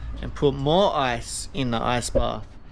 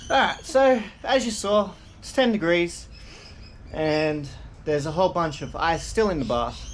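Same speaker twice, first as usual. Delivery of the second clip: ordinary talk, nothing marked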